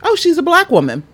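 high excited wonder; fascinated. Retold or imitated. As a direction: gush over it in a high voice.

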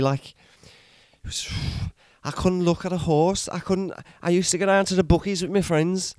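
a put-on accent drifting Welsh and Scottish; wandering Irish-ish accent. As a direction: play a ranting character in a shaky put-on accent. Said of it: "Your accent went very Welsh".

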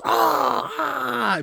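high wincing ah ah, half crying; pained crowd groan. Retold or imitated. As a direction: groan like a hurt audience. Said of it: "Everybody in the theater was".

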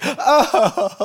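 high put-on fake sob; high theatrical fake whimper; mock baby fake cry. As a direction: imitate a baby hamming up a cry. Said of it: "she'd be like".

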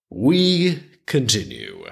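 slow, drawn-out announcement; resume the tale with mock ceremony; storyteller's resumption. Real, not acted.

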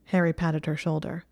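ordinary delivery

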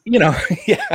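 real laughter breaking into the words; laughing at own joke. Real, not acted. Laughing at own America joke to co-host. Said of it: "Javad loves it when I do that".

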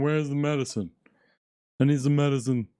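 talking through a blocked nose; mock stuffed-up plea to Steph; a hint of a congested nose; plugged-nose voice. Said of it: "that's my impression when my nose is plugged".

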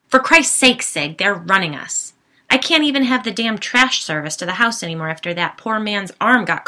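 loud exasperated rant; angry shouting. Acted. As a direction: yell it out, fed up. Husband yelling back in quarrel.

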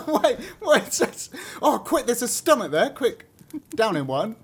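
high, laughing, excited outburst; mock urgency. Listener imagines the wolf spotting a stomach, excitedly urgent. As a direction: play a frantic wolf, laughing through it. Co-host playfully acts hungry wolf.

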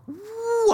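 high-pitched "woo" of a climbing plane; imitated whoosh. Storyteller imitates the plane taking off, high whoosh. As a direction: sail the sound up high.

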